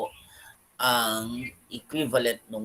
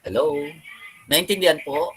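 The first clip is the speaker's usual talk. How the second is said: higher, louder calling out; to someone hard of hearing; 4 semitones higher, 4 dB louder, much faster than usual. Real, not acted. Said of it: "Speak up, please".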